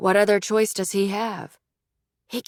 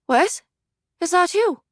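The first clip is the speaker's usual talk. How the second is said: high, hopeful mother; 11 semitones higher, 3 dB louder than usual. Acted. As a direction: lift into the mother's voice. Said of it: "This time his mother speaks".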